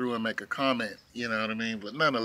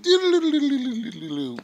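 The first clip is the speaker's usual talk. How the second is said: ball bounce noise; 10 semitones higher, 4 dB louder than usual. Retold or imitated. Imitating ball bouncing sound.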